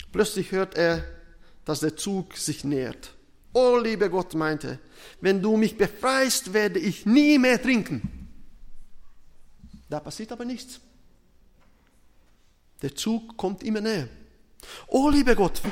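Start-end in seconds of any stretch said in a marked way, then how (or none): 3.5-4.3 loud, raised mock plea to God; pleading drunk man. Trapped man begs God for rescue, pleading. 5.2-8.0 loud, high-pitched mock vow; pleading promise. Trapped drunk promises God to quit drinking, pleading. Stuck man bargains with God. 14.9-15.6 high, loud cry to God; desperate cry. Acted. Stuck man cries to God as train nears, desperate.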